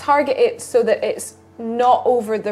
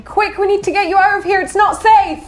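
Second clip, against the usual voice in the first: urgent rescuer; 9 semitones higher, 3 dB louder, faster than usual. Acted. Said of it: "Can I run into the darkness saying".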